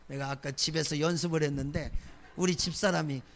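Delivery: ordinary talk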